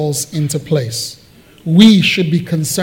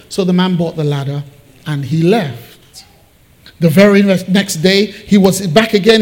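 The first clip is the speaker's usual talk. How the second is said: ordinary talk, nothing marked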